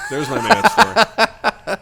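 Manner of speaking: high, loud, laughing. Real, not acted. Owning the made-up story, laughing high and loud. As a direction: laugh through it, pleased with yourself.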